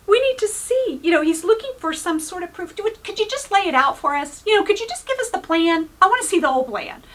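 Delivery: impatient mock plea for proof; demanding disciple. Philip demanding proof, acted.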